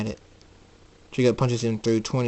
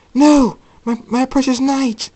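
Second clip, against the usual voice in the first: loud, high cry of dismay; anguished shout; 8 dB louder than usual. Acted. Ember Moon as the sky turns to day, crying out. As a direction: wail in protest at the loss. Villain sees her night vanish.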